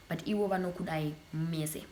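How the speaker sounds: ordinary talk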